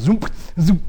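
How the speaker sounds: imitating cars zooming past, loud; car zoom sound. Preacher acts out cars speeding past him, loud zooming. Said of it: "heard cars".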